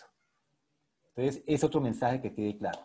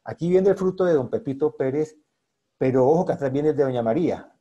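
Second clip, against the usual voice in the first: loud inner thought; 7 dB louder than usual. Retold or imitated. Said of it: "en qué momento le digo".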